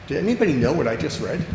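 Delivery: ordinary talk, nothing marked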